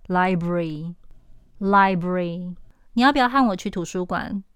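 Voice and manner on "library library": library said slowly as li-bry, twice; slow clipped library; 两音节library; two-syllable library. Shown as a demonstration. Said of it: "两个音节".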